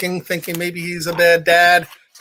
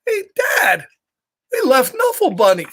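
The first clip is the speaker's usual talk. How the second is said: put-on toddler crying; 4 semitones higher than usual. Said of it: "She was trying to say".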